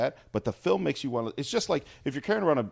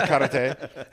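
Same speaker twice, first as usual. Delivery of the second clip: karate said through a laugh; 3 semitones higher, 7 dB louder than usual. Real, not acted. Podcaster repeats karate, laughing. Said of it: "laughs karate".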